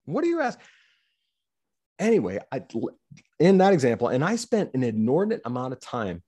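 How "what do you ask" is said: high-pitched exasperated rant; exasperated retort. Retold or imitated. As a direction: vent the disbelief in a raised, incredulous pitch. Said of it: "I'm like".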